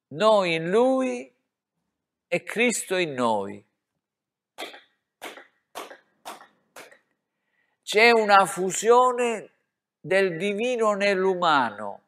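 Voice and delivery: fervent preacher's exaltation; ecstatic preaching. Real, not acted. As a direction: lift it up in rapture.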